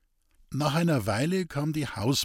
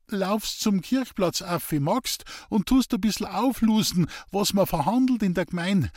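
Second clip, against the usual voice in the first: high, insistent dialect voice; lightly voiced country man giving an errand; sick man coaxing; 7 semitones higher than usual. A narrator's reading. Sick man sends boy to listen at church, coaxing.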